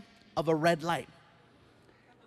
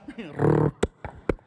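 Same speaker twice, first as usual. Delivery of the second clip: laugh; 6 semitones lower, 4 dB louder than usual. Real, not acted. Comic aside after grim joke.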